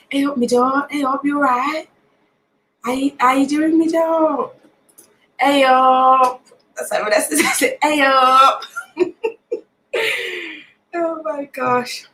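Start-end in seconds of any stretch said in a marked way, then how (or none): 0.1-6.4 higher, sing-out Nottingham hellos; Nottingham greeting. Shown as a demonstration. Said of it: "We say". 7.8-8.6 high, loud Nottingham "hey up"; Nottingham greeting. Shown as a demonstration. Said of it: "kind of like how we say hey or hi".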